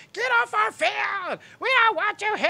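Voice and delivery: angry crowd yell. Retold or imitated. Retold hostile crowd yelling.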